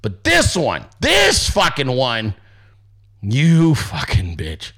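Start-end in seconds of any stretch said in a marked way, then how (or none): 3.2-4.7 lower, slow, dragged-out insult; mock contempt. Streamer names the worst blocker, slow low hammed-up contempt.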